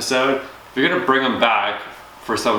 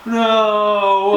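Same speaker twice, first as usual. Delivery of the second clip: mock Cora at hell's gate; 11 semitones higher, 4 dB louder than usual. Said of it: "she's just gonna be like".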